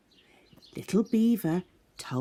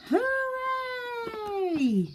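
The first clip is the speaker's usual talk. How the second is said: high distant drawn-out cheer; echo shouting back. Acted. The echo cheering back to the friends, distant high shout. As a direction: call it back from far across the pond. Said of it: "the echo shouted back".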